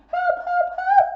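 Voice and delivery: very high loud piggy squeals; terrified pigs running to their brother; squealing for help. Acted. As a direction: scream for help. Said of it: "they squealed".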